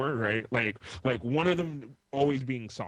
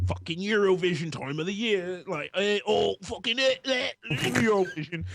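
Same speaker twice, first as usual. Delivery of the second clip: mock British accent; 7 semitones higher than usual. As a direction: send up Europeans in a jokey British accent. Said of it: "Is that your British accent?".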